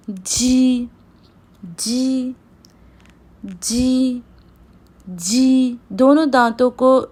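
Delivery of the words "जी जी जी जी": जी said with both rows of teeth joined; pinyin j sound. Shown as a demonstration. Teacher repeating pinyin j for students. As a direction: join the teeth and say जी. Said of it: "Chinese में जे को पढ़ते हैं जी".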